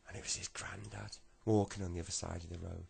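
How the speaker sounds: ordinary delivery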